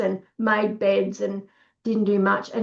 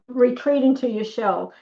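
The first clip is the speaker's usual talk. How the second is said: normal talking voice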